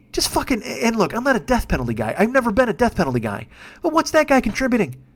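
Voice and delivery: ordinary talk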